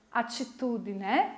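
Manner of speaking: ordinary talk, nothing marked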